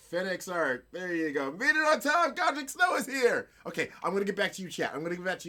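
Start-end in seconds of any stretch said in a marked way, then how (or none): 1.5-3.5 high, loud burst of welcome; excited call-out. Real, not acted. Streamer hailing a viewer who made it on time, excited call-out. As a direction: announce the arrival with a jump in pitch. Greeting a viewer just arriving.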